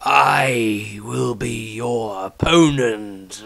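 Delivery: very slow, grave elder's voice; the patriarch challenging Maestro, slow and grave; stern old patriarch. Acted. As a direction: weigh every word like a judge. Patriarch speaks slowly.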